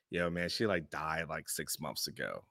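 sinking low and quiet, the words fading away; low, hushed news. Retold or imitated. Buddy quietly telling me his wife died, low and hushed. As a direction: drop to a hush as if breaking bad news.

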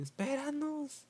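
muy agudo y lento, llamando de lejos; grito lejano. Retold or imitated. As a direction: grita para que lo esperen. Amigo grita desde atrás. (grito agudo llamando)

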